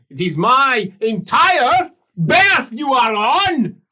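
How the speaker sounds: high, loud and insistent creditor; voice from below. Acted. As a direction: press him to stay and pay.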